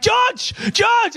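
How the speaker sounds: very high frantic shouted name; calling out. Retold or imitated. Dad yelling after his son on the beach, frantic and high. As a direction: yell the name after a runaway kid.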